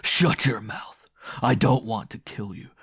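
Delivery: loud, forceful commands with hard pauses; ordering. A narrator's reading. Man stops the woman's pleas, ordering loudly. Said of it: "He ordered".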